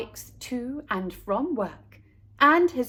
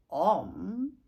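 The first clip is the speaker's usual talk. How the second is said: puzzled; 3 semitones lower, 3 dB quieter than usual. Acted. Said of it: "wondered Mister Buckmeister".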